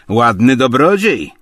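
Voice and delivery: loud, high spiteful jab; malicious. A narrator's reading. Tarantiew mocks Obłomow's benefactor, malicious. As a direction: sneer it out loudly. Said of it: "zauważył Tarantiew złośliwie".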